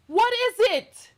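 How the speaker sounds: exasperated high question. Real, not acted. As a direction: push the question up in disbelief. Rhetorical question, pitched up.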